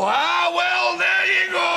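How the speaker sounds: loud high strained groan, lifting something; old man groan. Retold or imitated. Old man groaning loudly while picking things up. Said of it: "I pick things up and I go".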